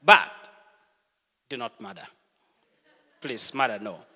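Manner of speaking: ordinary talk, nothing marked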